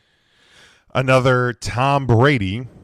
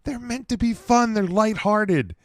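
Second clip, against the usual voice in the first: much higher, excited insistence on fun; excited high emphasis; 12 semitones higher than usual. Real, not acted. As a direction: lift the pitch way up, insisting. Emphatic restatement of point.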